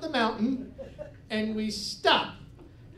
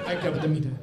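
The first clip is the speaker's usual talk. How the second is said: put-on Greek cabbie accent; 8 semitones lower, much faster than usual. Repeating cabbie line.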